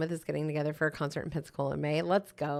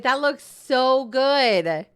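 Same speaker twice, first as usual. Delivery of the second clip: very slow, louder, higher amazed drawl; drawn-out amazement; 6 semitones higher, 8 dB louder, much slower than usual. Real, not acted. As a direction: stretch every word in awe. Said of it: "Wait a minute".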